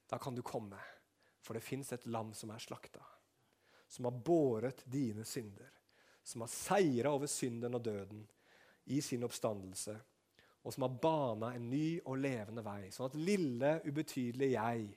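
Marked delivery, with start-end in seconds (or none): none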